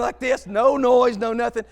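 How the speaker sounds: ordinary delivery, nothing marked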